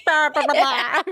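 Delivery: high, loud nonsense-syllable Jamaican imitation; mock Jamaican accent. Host retelling a viral video, mock Jamaican accent. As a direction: blurt a badly faked Jamaican accent. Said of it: "asks her to do a Jamaican accent".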